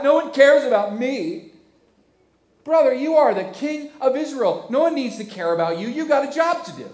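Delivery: high, loud petulant complaining; sulking king whining to his servants, high and loud; whiny sulking king. Acted. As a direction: whine like a pouting king. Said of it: "looks at his servants and goes".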